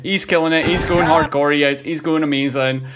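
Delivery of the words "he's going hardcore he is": usual speaking voice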